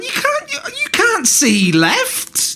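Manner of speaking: high, slow, laughing disbelief; dad laughing in disbelief at his son; exasperated. Real, not acted. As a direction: stretch it out, half laughing at the absurdity. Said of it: "exasperated at son's logic".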